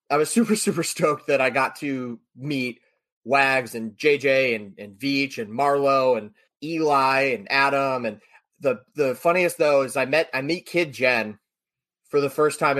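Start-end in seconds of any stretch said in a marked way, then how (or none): none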